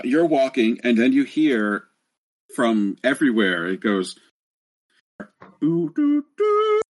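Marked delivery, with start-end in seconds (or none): 5.6-6.8 high sung chime notes; ding like a ship intercom. Retold or imitated. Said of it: "It sounds like an intercom going on".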